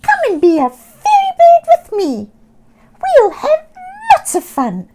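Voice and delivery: high, loud, fast squawking invitation; eager seagull. Acted. Sammy inviting Oscar to fly with her, high excited squawk. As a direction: squawk it eagerly like a bird full of fun. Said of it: "Sammy suggested".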